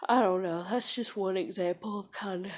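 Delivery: ordinary delivery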